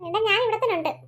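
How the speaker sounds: ordinary delivery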